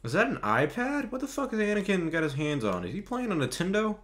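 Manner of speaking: high, incredulous disbelief; amazed at the screen; surprised. Real, not acted. Baffled at Anakin's gadget.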